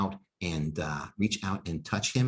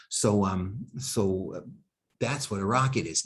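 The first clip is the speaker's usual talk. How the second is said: ordinary talk, nothing marked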